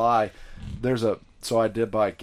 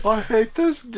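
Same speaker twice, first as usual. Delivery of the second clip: mock despair; 13 semitones higher, 6 dB louder than usual. Mock despair after the skit.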